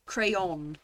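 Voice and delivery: "Crayon" as cray-ON, falling and certain; own pronunciation. Shown as a demonstration. Brit correcting to her own "Crayon", cray-ON. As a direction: say "Crayon" with a rounded ON ending. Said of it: "it's clearly an O N".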